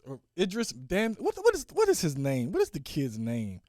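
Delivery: ordinary talk, nothing marked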